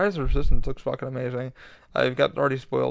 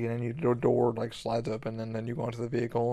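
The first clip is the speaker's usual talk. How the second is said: ordinary talk, nothing marked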